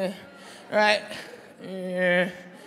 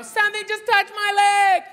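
high-pitched movie-heroine alarm; hammed-up high panic; girl in mock fright; 6 semitones higher, 3 dB louder than usual. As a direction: cry out high, as the startled girl in a trailer. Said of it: "you hear the girl go".

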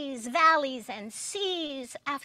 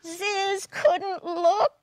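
slow, higher, laughing through it; 7 semitones higher, 6 dB louder, slower than usual. A narrator's reading. Storyteller giggling at Ziz's clumsy crash, laughing. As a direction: giggle at the mess.

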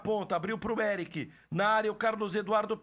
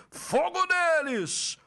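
excited catchphrase; 6 semitones higher, much slower than usual. Real, not acted. Celebrating goal catchphrase.

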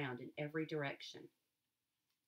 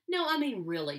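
persisting; 4 semitones higher, 8 dB louder than usual. A narrator's reading. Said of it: "she persisted".

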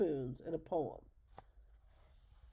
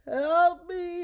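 loud high plea for help; pleading; 12 semitones higher, 13 dB louder than usual. Acted. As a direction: beg for help in panic. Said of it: "he pleaded".

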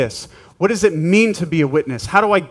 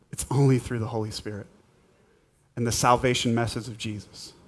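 soft, much quieter afterglow; hushed; 6 semitones lower, 8 dB quieter than usual. Real, not acted. Pastor quietly closes the prayer time, hushed and soft. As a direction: settle it down gently.

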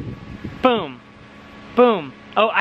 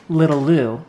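tender; 7 semitones lower than usual. Real, not acted. Talking to unborn baby.

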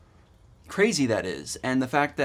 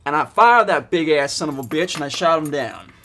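lightly put-on Southern accent, getting louder; 6 dB louder than usual. Accent time for viewers, a bear tale in a mock Southern accent. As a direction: tell the bear story in a hammed-up Southern accent. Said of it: "I was going for Southern".

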